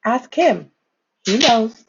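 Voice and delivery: ordinary delivery, nothing marked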